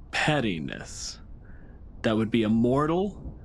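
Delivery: normal talking voice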